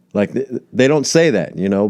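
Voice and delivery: ordinary talk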